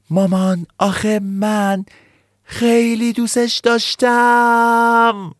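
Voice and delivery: put-on high, slow, tearful child's cry. Nini cries out to mom about the deer, tearful. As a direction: wail it like a heartbroken little kid. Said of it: "فریاد زد".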